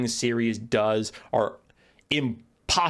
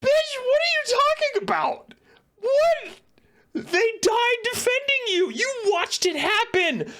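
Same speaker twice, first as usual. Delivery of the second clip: exasperated rant. Retold or imitated. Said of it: "I'm like".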